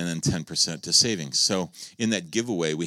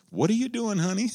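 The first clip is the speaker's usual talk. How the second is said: mock sweet question. Mock sweet question to stopped driver.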